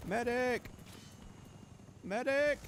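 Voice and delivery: yelling for a medic, high; man down shout. Real, not acted. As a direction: call out desperately for help. Calling for medic in game.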